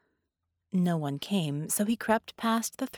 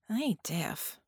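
frank patient; 4 dB quieter, slower than usual. A narrator's reading.

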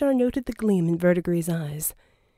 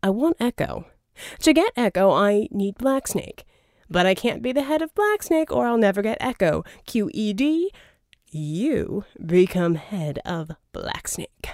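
louder, smooth persuasive schemer; a charming villain's pitch, louder; Verdigris voiced; 4 dB louder than usual. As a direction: sell the deal, oily and grand. Verdigris explaining reasons.

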